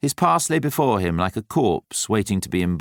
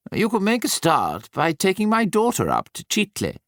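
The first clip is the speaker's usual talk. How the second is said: lightly voiced Nicholas, pitched higher; a hint of Nicholas, gently pushing; Nicholas's voice; 11 semitones higher than usual. A narrator's reading. Nicholas pressing Patrick.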